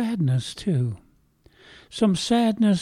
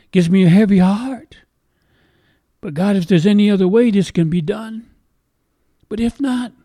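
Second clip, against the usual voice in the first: louder, higher, pressing cry to God; anguished plea; 6 semitones higher, 6 dB louder than usual. Acted. Preacher voicing a troubled prayer to God, louder anguished plea. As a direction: push it out like a heavy-hearted plea.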